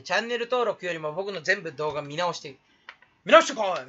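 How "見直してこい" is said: a louder, bossy mock command; hammed-up order to rewatch; mock shouted order. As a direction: bark it at the viewers as a joke. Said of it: "みたいなね".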